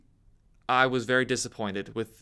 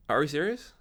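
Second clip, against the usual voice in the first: usual speaking voice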